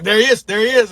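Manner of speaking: retold excited cry; excited spotting call. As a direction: sing out the find to the group. Friends spot the deer.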